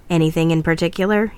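plain reading voice

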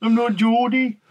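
high, loud mock Geordie voice; Geordie accent. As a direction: put on a Newcastle accent. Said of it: "Let's do the Geordie accent".

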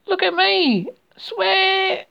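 very high, sustained squeal; Roo's squeak. A narrator's reading. Roo showing off while going under, high squeak. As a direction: squeal it out, holding the words.

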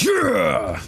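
high, drawn-out whoop; acting out a thrilled shout; gleeful cheer. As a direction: let out a high, bloodthirsty cheer. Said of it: "That got me like that".

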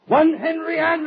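high, loud nasty sneer. Retold or imitated. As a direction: sneer it out, mocking and superior. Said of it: "in his nasty little sneering voice".

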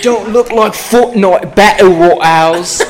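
loud, high, slow hammed-up British; exaggerated British, almost shouted; British accent. Shown as a demonstration. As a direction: stretch it out in a broad British accent. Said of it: "I can do a British one".

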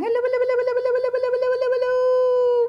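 rapid-fire high hellos; as fast as we can. Shown as a demonstration. Teacher racing through hellos with the little ones. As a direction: rattle them off as quick as you can. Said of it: "Let's say hello as fast as we can".

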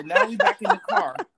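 high and loud through laughter; laughing. Real, not acted. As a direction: laugh out the words, pitch up. Friend laughing at the story.

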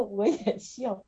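笑着慢慢说; 笑出声来说; laughing. Real, not acted. (看到姊妹笑，自己也跟着笑)

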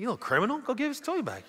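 high-pitched, half-laughing telling-off; mock scolding. Adult to a toy-stealing child, mock scolding.

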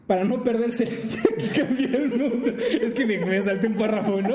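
high, laughing through the words. Real, not acted. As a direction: crack up mid-sentence. Laughs through botched reading.

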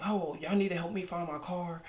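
irritated customer, hammed up; mock angry guest. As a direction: imitate a pushy guest demanding help. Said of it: "he was like".

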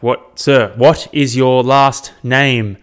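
loud and very slow, every word spelled out; slow loud repeat. Retold or imitated. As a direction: drag it out loud for a deaf man. Receptionist repeats to deaf man.

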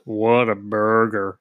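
robot voice. Retold or imitated. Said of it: "It's like a robot voice".

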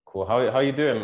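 ordinary delivery

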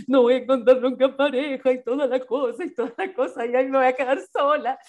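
high, whiny lament of being alone; mock despair. Woman mimics her old self crying about staying single, hammed-up whine. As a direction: ham up the lonely wail.

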